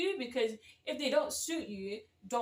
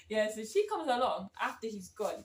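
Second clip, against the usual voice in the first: ordinary talk, nothing marked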